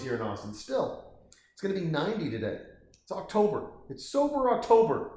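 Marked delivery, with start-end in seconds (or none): 3.9-5.1 playful high voice. Acted. Playful joke line.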